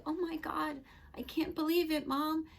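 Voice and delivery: high-pitched, disbelieving reaction to Mom; retold amazement. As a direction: re-enact being amazed, pitch held high. Reenacts disbelief at mother's call.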